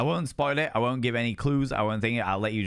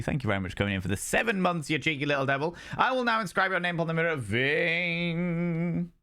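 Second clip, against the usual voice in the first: much higher, fast gushing shout-out; hyped-up thanks; 7 semitones higher than usual. Acted. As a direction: gush over the subscriber like a game-show host.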